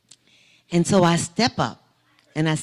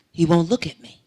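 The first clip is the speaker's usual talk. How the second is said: normal talking voice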